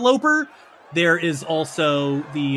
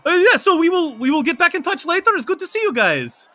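high-pitched, hurried vampire goodbye; friendly vampire; 14 semitones higher, 7 dB louder than usual. Acted. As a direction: call back cheerily while being dragged off. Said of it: "looks back at you guys and goes".

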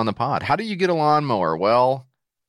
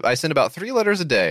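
normal talking voice

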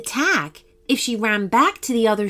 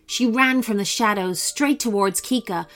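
ordinary delivery

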